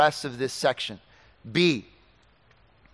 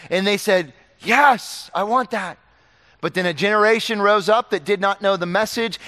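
loud, high, driving sermon voice; fiery preacher's delivery, higher and louder; full-force preaching; 3 semitones higher, 5 dB louder than usual. Acted. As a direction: preach it hard to the congregation.

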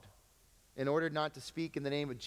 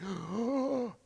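quick high alarmed gasp; sharp gasp; 8 semitones higher than usual. Acted. Pastor reacting to what worries him, a gasp. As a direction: gasp at the thought. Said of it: "I'm like".

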